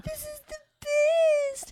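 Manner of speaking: very high, squealing mock drunk joy; drunk excitement. Imitating her drunk self at a party, high squeal. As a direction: squeal it like you're tipsy at a party. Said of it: "I'll be like".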